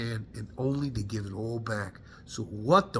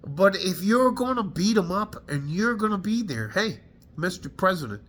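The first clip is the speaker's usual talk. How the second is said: higher, hot and indignant, daring the President; angry rant; 4 semitones higher than usual. Real, not acted. Veteran daring the President onto the front lines, angry. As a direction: call out the leaders, furious. Veteran ranting at war leaders.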